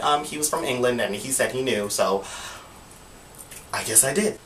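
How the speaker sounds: usual speaking voice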